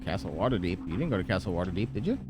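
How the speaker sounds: ordinary talk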